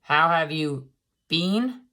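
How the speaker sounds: slow, plain, stretching been. Acted. Narrator explains the been pun to listeners, slowly. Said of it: "Get it?".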